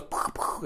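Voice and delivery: whispered puff of a gunshot; gunshot sound. Shown as a demonstration. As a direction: breathe out soft poofs like shots. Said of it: "when they shoot a regular gun, it goes".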